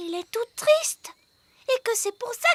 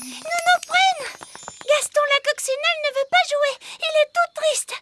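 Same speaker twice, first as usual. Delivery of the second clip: pleading call; 5 semitones higher, 3 dB louder than usual. Acted. Enfants appellent et supplient la nounou.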